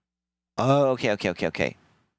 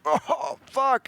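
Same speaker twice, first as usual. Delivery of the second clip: high, stunned cry; mock dismay. Shooter realising his mistake, high mock dismay. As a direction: realise too late what you did. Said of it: "He's like".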